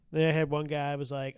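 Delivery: ordinary talk, nothing marked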